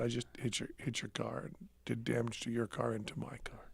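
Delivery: hushed, sheepish whisper of a confession. Retold or imitated. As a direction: breathe it out softly, almost unvoiced, like admitting guilt. Confessing the crash to wife.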